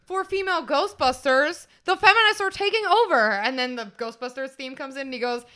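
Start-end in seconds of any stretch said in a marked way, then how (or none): 0.0-3.3 high, loud, indignant rant; hammed-up outrage; imitated nerdy guy. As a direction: declaim it like a nerd sounding the alarm. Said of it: "where he's like".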